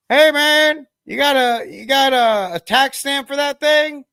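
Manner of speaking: high, loud, hammed-up agent voice, slowing at the end; squeaky cartoon fed shouting across the woods; mock ATF agent voice. Gun-meme agents calling to a guy in the woods, high mock voice. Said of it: "they're like".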